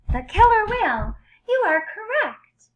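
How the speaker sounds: loud, high cheer of the answer; high, loud celebration of the right answer; excited reveal. Acted. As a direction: announce it like a prize winner.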